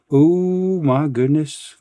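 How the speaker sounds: ordinary delivery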